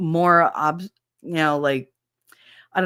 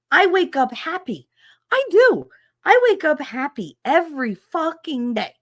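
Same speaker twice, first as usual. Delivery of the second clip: much higher, pitched-up insistence; exasperated outburst; 11 semitones higher, 3 dB louder than usual. Real, not acted. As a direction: insist you wake up happy, voice climbing. Ranting about unwanted feelings.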